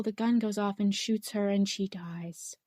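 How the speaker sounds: hushed aside; whispered. Real, not acted. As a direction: whisper the sad twist. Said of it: "whispers the tragic twist".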